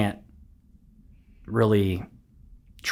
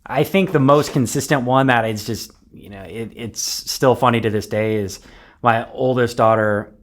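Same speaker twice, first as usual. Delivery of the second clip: usual speaking voice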